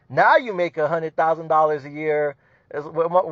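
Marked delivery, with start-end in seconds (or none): none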